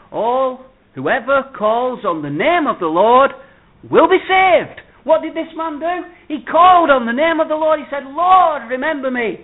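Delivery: loud, high gospel preaching; preaching at full force. Acted. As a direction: proclaim it to the whole hall.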